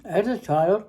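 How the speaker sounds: ordinary talk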